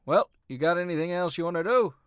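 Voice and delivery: high, loud, quick character voice; Gigi. Acted. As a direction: call out the question, pitch climbing. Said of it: "Gigi says".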